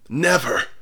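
sharp, loud, high cry; loud, defiant cry from the deathbed; cried out. A narrator's reading. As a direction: fling the word out with last strength. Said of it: "cried the veiled clergyman".

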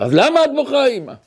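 put-on high, pressing child's question; worried young son. Young son presses his crying mother for the reason, high-pitched and worried. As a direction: plead upward as a puzzled little boy.

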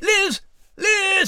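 high, ringing calls across the room; calling out. Acted. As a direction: shout her name to find her. Searching for the cat.

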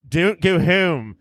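a light Pennsylvania accent, slow and drawn out; a hint of a Philly accent. Shown as a demonstration. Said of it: "Can you give us a little sampling?".

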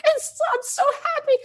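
very high, tearful squeak of joy; happy cry. Retold or imitated. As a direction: act choked up with happiness. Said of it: "tell her about the happy cry".

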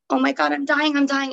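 replaying the terror of thinking she's dying; imitating her own frantic panic; retold panic. Retold panic attack outside hall.

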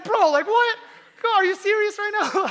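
high, loud mock outrage; incredulous outburst. Friend whose gift of water is poured out, incredulous outburst. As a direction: explode in comic disbelief.

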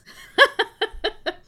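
a high, loud burst of laughter. Real, not acted. Laughing at life before kids.

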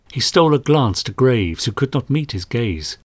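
usual speaking voice

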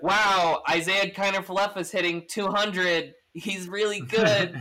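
high, loud put-on amazement; mock fan hype. Imitating Yankees fans hyping their shortstop, mock-impressed. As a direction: gush loudly like a fan, stretching the last words.

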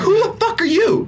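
incredulous outburst, pitch leaping way up; very angry. Retold or imitated. Retelling her comeback to the cussing man, very angry. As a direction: fire back in high, heated disbelief. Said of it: "It's coming off very angry in this moment".

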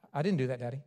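a hint of a small boy denying it; little son's soft denial; voiced small child.

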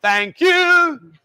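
high, loud, sing-song thanks; loud thank you. Acted. Leading the girls in thanking drinks buyers, loud and high. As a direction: belt out a big showy thank you.

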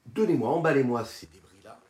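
loud, insistent ask; loud eager request. Retold or imitated. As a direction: call it out eagerly. Shlomo's eager request to the florist.

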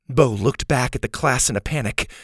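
plain reading voice

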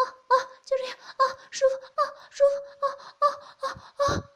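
high, loud moans building to near screams. Acted. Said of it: "呻吟得更大声".

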